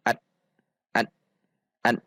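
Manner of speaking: an chopped off abruptly; jerked, cut short. Shown as a demonstration. Teacher shows forbidden reading, an jerked short. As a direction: jerk an short, cutting the N dead. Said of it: "maka tidak boleh dihentak".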